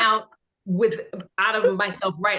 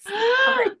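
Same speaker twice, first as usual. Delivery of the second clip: a much higher, louder squeak of shock; stunned squeal; 5 dB louder than usual. Retold or imitated. Reading Star Trek on the script, with a much higher stunned squeak. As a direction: squeak it out in disbelief.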